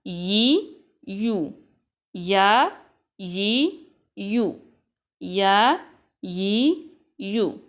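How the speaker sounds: या, यी, यू chanted in a clear sequence; vowel drill. Shown as a demonstration. Teacher leads students through या यी यू. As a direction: drill the three vowel sounds evenly. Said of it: "उसके बाद".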